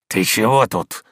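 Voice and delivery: a touch of hoarse gruffness. A narrator's reading. Servant confronts the eavesdropping maid, hoarse. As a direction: challenge her in a slightly husky voice. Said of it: "спросил он хрипло".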